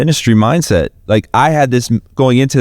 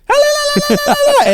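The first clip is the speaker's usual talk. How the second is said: very high sung la-la war cry; war call imitation; much faster than usual. Retelling the group's mid-song call, high mock wail. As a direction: wail it out like a chant in the middle of a song. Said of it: "an Indian war call".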